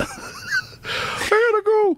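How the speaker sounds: laughing through the words; words breaking into laughter. Real, not acted. Bursts out laughing at Bert story.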